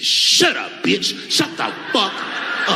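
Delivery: yelling threats at a heckler, hammed-up; mock rage shout. Mock outburst at heckler, then denied.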